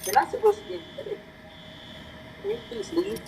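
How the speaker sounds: mocking high voice. Character tells little boy to leave.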